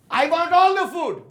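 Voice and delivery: high, loud demand in an Indian accent. Shown as a demonstration. Teacher models what to say at the buffet, loud Indian accent. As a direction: shout it like a hungry customer, Indian accent. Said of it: "What are you gonna say at the Indian buffet?".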